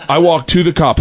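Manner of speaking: usual speaking voice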